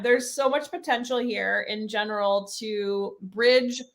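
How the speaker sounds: usual speaking voice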